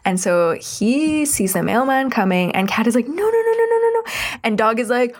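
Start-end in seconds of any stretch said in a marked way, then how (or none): 3.1-4.1 high-pitched rapid string of protest; frantic objection. Retold or imitated. Cat trying to stop Dog at the mailman's arrival, frantic. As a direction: imitate a panicked cat, voice jumping way up.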